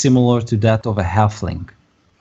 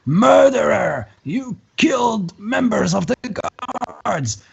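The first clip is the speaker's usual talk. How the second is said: high, drawn-out scream for help; screaming guard call; 13 semitones higher, slower than usual. Acted. Game master voices Izek calling the guards, screaming. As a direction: cry out murder, voice pitched way up. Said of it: "starts screaming out of the top of his lungs".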